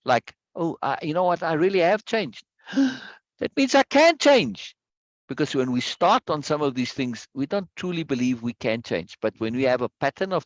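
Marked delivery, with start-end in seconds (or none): 2.7-4.8 gasp, then slow, high, louder amazement; slow awed delight on can; excited realization. Shown as a demonstration. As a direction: act out discovering you can change. Acting out realizing change.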